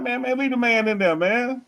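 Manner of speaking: high, pleading protest; exasperated plea. Retold or imitated. Host protesting the clip cut, high pleading voice. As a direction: beg them to keep the man in the clip.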